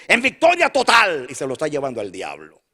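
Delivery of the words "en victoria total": mock triumphant cry. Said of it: "Yo conozco gente que dice".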